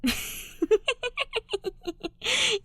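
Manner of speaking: put-on high, long drunk laugh; long tipsy laugh; drunk laughter. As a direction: laugh harder, giddy. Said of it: "the other boy laughs harder".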